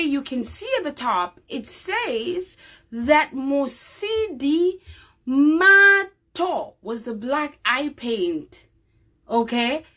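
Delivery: ordinary delivery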